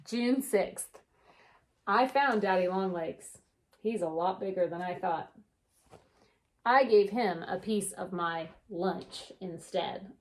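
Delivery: gentle storybook read-aloud; unhurried picture book narration; reading to children. A narrator's reading. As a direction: read it like story time for kids.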